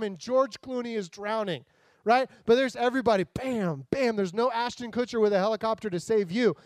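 usual speaking voice

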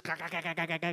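rapid-fire imitation of munching; mock munching sound effect; chomping worm noise. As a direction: chomp through it in quick repeated bursts. Imitates a worm devouring the plant.

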